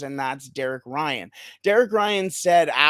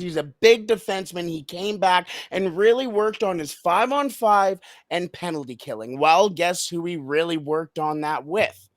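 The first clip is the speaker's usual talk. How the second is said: usual speaking voice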